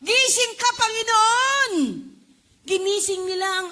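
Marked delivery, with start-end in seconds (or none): 0.0-1.7 loud, high panicked cry; frantic shout for help; panicked disciples. Acted. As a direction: cry out in terror to the Lord. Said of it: "Nagpapanik na sila".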